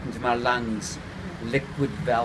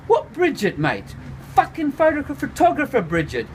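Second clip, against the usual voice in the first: incredulous mate; 13 semitones higher than usual. A narrator's reading. Voodoo grills the narrator about Bridget, high and incredulous. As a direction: demand which one, pitched up.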